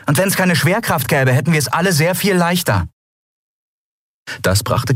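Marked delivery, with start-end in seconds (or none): 0.0-2.9 high, tart sarcastic comeback; higher-pitched, mocking dismissal; venomous. A narrator's reading. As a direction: retort with a touch of venom. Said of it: "sagte Endriel giftig".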